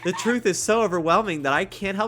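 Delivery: high, loud and laughing; excited. Acted. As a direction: gush with giddy energy. Voicing excited game character.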